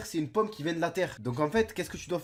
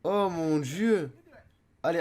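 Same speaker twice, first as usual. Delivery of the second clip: ordinary talk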